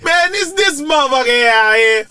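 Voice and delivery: high, loud mock roast; hammed-up heckler ripping into someone. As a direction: draw out the call-out like a roasting comic. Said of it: "man will roast you for going toilet".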